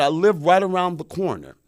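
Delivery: high, loud, insistent plea; begging. Retold or imitated. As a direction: plead urgently with a stranger. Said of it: "I'm begging the lady".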